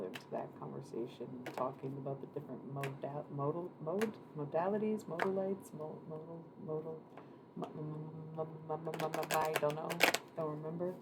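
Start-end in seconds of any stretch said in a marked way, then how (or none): 5.8-9.9 stumbling over the word; stammering. Real, not acted. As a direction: stutter on the m, then give up.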